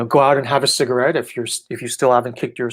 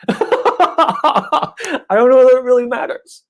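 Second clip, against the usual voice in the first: much higher, louder and slow through a laugh; laughing, hammed-up repeat; 12 semitones higher, 6 dB louder, much slower than usual. Shown as a demonstration. Repeating her own answer to the questioner, hammed up with laughter. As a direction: stretch it out, laughing high and loud. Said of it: "repeats own line, hammed up".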